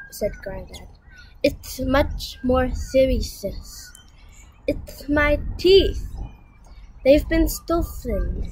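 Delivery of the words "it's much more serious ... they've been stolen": slow, gummy toothless voice; grave, slow lisping grandfather; toothless speech. Acted. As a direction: sound out the news slowly without teeth. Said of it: "Why is Granddad talking funny?".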